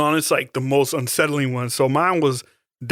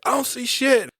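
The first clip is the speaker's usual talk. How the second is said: nervous high denial; 10 semitones higher than usual. Retold or imitated. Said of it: "And he's like".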